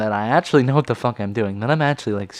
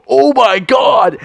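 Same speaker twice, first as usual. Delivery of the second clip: high, loud amazed shout; class gasps; 13 semitones higher, 7 dB louder, slower than usual. Retold or imitated. The whole class shouting in amazement at the open lock. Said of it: "everyone in the class is like".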